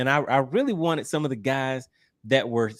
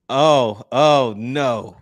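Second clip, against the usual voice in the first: loud, laughing "oh no"; amused alarm, much louder; loud dismay; 7 dB louder, much slower than usual. Real, not acted. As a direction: groan out loud as the troublemaker appears. Troublemaker turns up in the chat.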